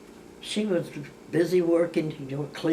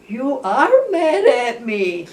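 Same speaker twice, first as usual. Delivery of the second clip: higher, louder and slow mock accusation; drawn-out sister voice, pitched up and loud; imitating the sister; 8 semitones higher, 9 dB louder, much slower than usual. Retelling her sister's reproach, higher, louder and slow. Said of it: "She went".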